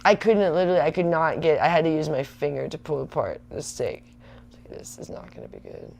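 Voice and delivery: ordinary talk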